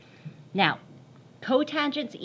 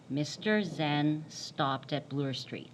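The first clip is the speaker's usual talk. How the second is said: slow careful reading; 5 semitones lower, 5 dB quieter, much slower than usual. Shown as a demonstration. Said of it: "Someone read that to me".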